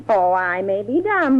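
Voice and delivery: usual speaking voice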